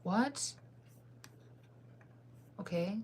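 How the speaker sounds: ordinary talk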